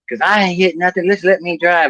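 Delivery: high, indignant granny; mock-defensive grandma; mean old grandma. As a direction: snap back in a high old-lady voice. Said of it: "she used to be a mean old lady".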